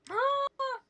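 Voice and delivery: sharp high shocked gasp; a startled gasp at the news. Real, not acted. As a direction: gasp in mock horror. Ear shot off reveal.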